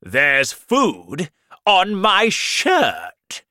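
a spoiled king yelling at his servants; angry shout. Acted. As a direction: bellow it like an outraged king. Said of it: "the king shouted angrily".

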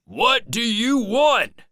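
loud, high challenge; shouting old man. A narrator's reading. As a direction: yell a demand at a stranger outside. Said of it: "shouted the Welshman".

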